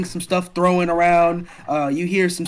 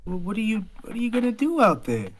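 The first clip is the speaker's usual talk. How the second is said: tentative concern; 7 semitones higher than usual. Acted. Girl worries about friend leaving.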